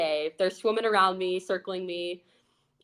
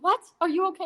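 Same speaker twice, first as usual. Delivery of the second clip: worried grandma; 10 semitones higher, much faster than usual. Retold or imitated. Grandma hears of shark bite.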